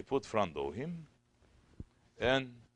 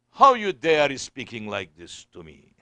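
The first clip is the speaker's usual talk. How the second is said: loud, affronted outburst; mock indignation; 3 semitones higher, 13 dB louder than usual. Preacher imitating an offended Khalifa, loudly indignant. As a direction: come down hard like an offended ruler.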